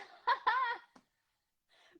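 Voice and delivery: high, laughing relief; joyful laugh. Real, not acted. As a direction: laugh out in delight. Connection finally works, laughing.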